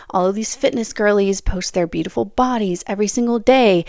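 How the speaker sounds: normal talking voice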